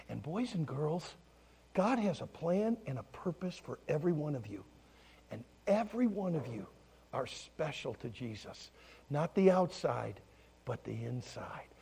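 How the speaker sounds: tender reassurance. Retold or imitated. Pastor telling children they are special, tender.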